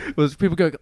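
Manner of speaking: normal talking voice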